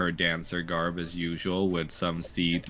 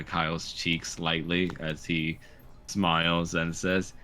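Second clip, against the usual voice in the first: usual speaking voice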